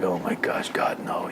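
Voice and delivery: low, hushed dismay; soft under-the-breath groan of reluctance; dreading aside. Retold or imitated. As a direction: mutter it softly to yourself, acting dread. Yankele dreading the soldiers.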